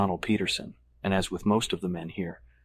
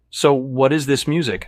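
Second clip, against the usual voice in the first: high, loud, exasperated question; thoroughly annoyed; 6 semitones higher, 7 dB louder than usual. A narrator's reading. Guest asking about the party music, thoroughly annoyed. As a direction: demand it with open annoyance. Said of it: "thoroughly annoyed".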